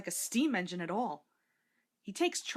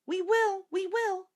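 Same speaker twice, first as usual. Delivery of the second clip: much higher, louder eager reply; children calling back; 11 semitones higher, 6 dB louder, slower than usual. A narrator's reading. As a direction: call back as you wave goodbye. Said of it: "called the children".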